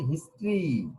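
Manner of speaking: হিস্ট্রি said in two syllables, his-tree; demonstrated pronunciation. Shown as a demonstration. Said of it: "উচ্চারণটা কি বলেছি?".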